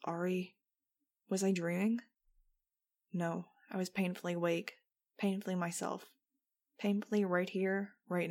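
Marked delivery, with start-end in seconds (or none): none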